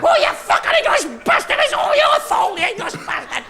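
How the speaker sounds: squeaky, very high Scottish ranting; yappy Scottish terrier. Acted. Comedian as little terrier Scotsman blaming the English, high Scottish yapping. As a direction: yap out the blame in a shrieking Scottish voice. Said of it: "your little yappy terrier jock".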